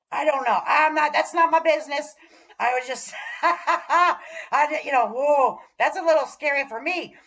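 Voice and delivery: uneasy laughing disbelief; nervous laugh. Real, not acted. Laughing nervously about her state.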